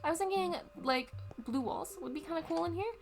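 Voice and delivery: talking through hiccups; hiccuping between words. Real, not acted. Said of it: "I have the hiccups".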